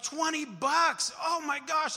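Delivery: high, loud and slow, thrilled by the money; excited kid. Retold or imitated. Older son reading his birthday card, high thrilled voice. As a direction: drag out the wonder like a delighted child. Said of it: "And he's so excited".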